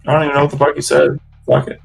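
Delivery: usual speaking voice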